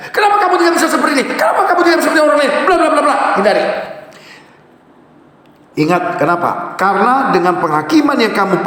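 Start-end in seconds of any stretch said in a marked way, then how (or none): 0.1-3.1 fast accusing nag. Shown as a demonstration. Spouse comparing partner to others, fast high nag. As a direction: rattle off the comparisons.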